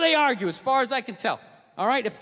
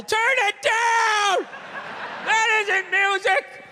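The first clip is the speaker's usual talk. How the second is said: mock old man yell; 8 semitones higher, much slower than usual. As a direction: bellow up at the noisy neighbours. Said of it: "screaming up at the younger couple".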